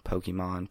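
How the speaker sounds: "Pokemon" said POH-kee-mahn; "Pokemon" with "kee" in the middle and "mahn" to end; variant pronunciation. Shown as a demonstration. Showing how people pronounce "Pokemon" differently, as POH-kee-mahn. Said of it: "Isn't it weird how everyone pronounces".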